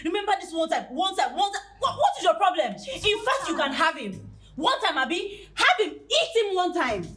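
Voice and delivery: high-pitched, exasperated tirade; screaming at them. Acted. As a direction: rant in a high voice, fed up. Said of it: "Why is she screaming like that?".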